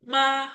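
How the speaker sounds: ma high and level; first tone. Shown as a demonstration. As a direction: hold ma on one high note. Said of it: "di nada pertama, nada datar".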